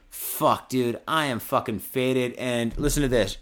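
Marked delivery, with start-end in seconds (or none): none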